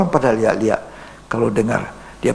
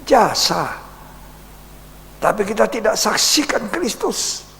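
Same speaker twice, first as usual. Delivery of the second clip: full-force preaching; 8 semitones higher than usual. Real, not acted. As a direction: rise up and preach it to the church. Preaching climax to congregation. (khotbah berapi-api)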